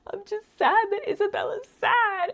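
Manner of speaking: high-pitched mock blubbering; imitated crying boy. Little boy cries for his sad friend, mock crying. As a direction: whine it way up high like a tearful little kid. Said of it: "she also made him cry".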